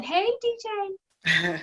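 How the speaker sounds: high, slow sing-song hello; playful greeting. Real, not acted. Mom waving hi to her son on the call, high sing-song.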